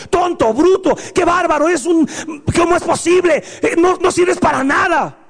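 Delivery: mock-yell insults at your son; demonstrating a parent's angry insults; mock angry scolding. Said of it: "decirle todas las cosas malas".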